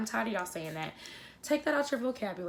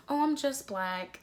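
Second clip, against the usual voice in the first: slower, higher mimicry of disappointment; mock disappointment; 5 semitones higher, 4 dB louder than usual. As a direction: imitate someone letting down their listener. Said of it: "say it like you are, like, you're disappointed".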